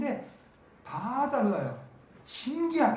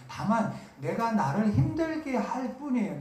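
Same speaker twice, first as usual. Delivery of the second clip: normal talking voice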